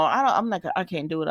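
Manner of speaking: ordinary talk, nothing marked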